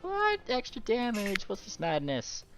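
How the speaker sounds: high, squeaky repeated what; surprised disbelief. Real, not acted. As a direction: shriek each what in disbelief. GM reacts to extra damage.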